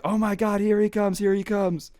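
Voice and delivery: high, rushed panic as he runs toward the car; oh my God, he's coming; panicked excitement. Retold or imitated. Friends in the car watching him run up, panicked and high.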